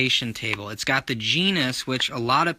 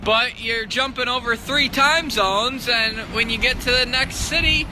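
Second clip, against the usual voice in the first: climbing higher and louder; building excitement; 12 semitones higher, 3 dB louder than usual. Real, not acted. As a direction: build up to shouted disbelief. Rising exasperated build.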